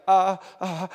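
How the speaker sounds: mock-nervous stalling for an answer; nervous hesitation. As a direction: act caught out and unsure what to say. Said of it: "she gets really nervous".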